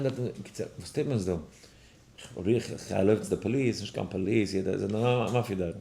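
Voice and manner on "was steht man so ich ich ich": usual speaking voice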